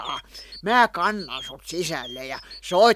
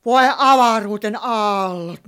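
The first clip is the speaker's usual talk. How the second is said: normal talking voice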